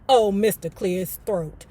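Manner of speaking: usual speaking voice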